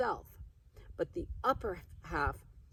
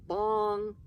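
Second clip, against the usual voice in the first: mock clock chime; 4 semitones higher, 6 dB louder than usual. Aunt mimicking the clock strike to the family, mock bong.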